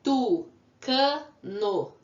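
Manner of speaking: three distinct beats for "Tucano"; syllable by syllable. Shown as a demonstration. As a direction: separate each syllable of "Tucano" to count it. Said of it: "Quantas vezes eu abri a boca".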